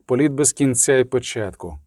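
ordinary delivery

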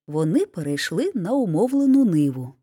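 usual speaking voice

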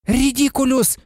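high, determined spell cry; mild high-pitched shout; Neville's exclamation. A narrator's reading. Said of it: "вигукнув він".

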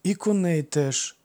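usual speaking voice

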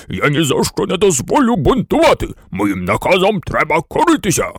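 forceful, commanding general's voice; blustering exclamation. A narrator's reading. General forbidding the toys to rebel, blustering command. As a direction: lay down the law in outrage. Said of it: "вигукнув генерал".

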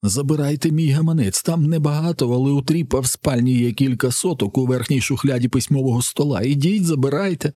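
high, anxious bargaining; frightened pleading. A narrator's reading. Terrified captive offers his money. (перелякано, високим благальним голосом)

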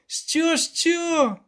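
very high incredulous double take; squeaky, scoffing repeat; snorting disbelief. A narrator's reading. Poet pressing the elder, high-pitched disbelief. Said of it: "пирхнув Любисток".

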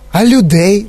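lightly voiced insistent kid; persistent boy. Mike pressing Wonka, insistent. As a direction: keep pushing the question. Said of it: "не вгавав Майк Т В".

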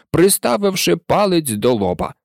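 usual speaking voice

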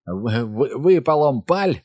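stammered start, then a high order; stuttered command. A narrator's reading. Bill orders the kids to throw, stuttered command. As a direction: stumble on the first sound, then burst out. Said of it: "віддав наказ Біл".

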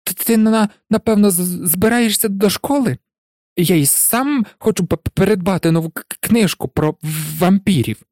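high-pitched nervous stammer; anxious professor stuttering small talk; Quirrell. A narrator's reading. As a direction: trip over the starts of words. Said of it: "затинаючись, вимовив професор Квірел".

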